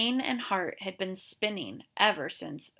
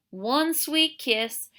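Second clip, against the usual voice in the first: slow leer; 8 semitones higher, 5 dB louder, much slower than usual. Acted. Drunk sheriff wheedling.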